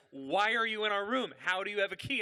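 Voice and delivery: high, loud mock outrage; acted-out alarmed confrontation; indignant demand. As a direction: demand answers from an intruder. Startled couple confronting an intruder.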